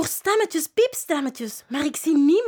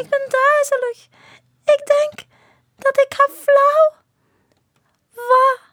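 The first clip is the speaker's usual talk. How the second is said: much higher, swooning princess voice; fainting princess; 11 semitones higher, 5 dB louder than usual. Acted. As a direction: sigh it like a dainty princess.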